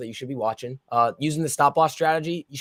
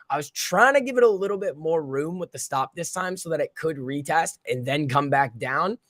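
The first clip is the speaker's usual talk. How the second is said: normal talking voice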